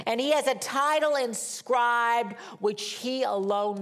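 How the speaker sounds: emphatic, swelling pulpit proclamation; impassioned scripture preaching. Acted. Preacher reading Revelation's vision of the rider, emphatic proclamation. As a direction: stretch the key words with awe and force.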